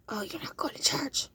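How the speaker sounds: whispered mock accusation; whispered jab. Trifling friend accusing, whispered mock. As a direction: hiss it like a gossip.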